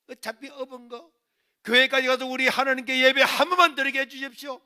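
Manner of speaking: loud, high pleading; fervent prayer. Retold or imitated. Son carrying mother to church, fervent loud prayer. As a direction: beg God with full voice.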